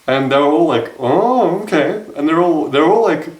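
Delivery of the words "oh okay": sudden high surprised oh, okay. Retold or imitated. Girls at the table reacting to his pickup line, surprised. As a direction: girls caught off guard by the blunt answer. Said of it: "surprised and".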